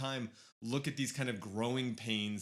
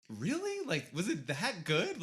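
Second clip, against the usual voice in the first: high, incredulous questioning, acted out; doubtful reply that starts high and slows down; retold doubt; 6 semitones higher, slower than usual. Moviegoer answering his excited friends, retold skeptical. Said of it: "and I was just like".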